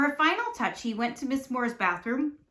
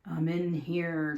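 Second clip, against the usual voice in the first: low, slow, lightly raspy wolf-as-granny; a slow, low, croaky answer from the bed; 5 semitones lower, 4 dB quieter, slower than usual. A narrator's reading. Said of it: "said a raspy voice".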